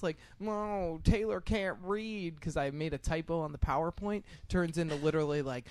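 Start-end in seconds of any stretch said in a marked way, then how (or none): 0.4-2.4 higher, drawn-out mocking singsong; childish taunt. Kids taunting a teacher over a typo, mocking. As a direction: taunt like a kid, dragging the words. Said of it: "like, tiny taunts".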